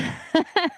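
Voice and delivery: short high-pitched chuckle; a brief, real self-amused laugh; light laugh. Real, not acted. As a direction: chuckle briefly at your own uncertainty. Amused at own unsure pronunciation.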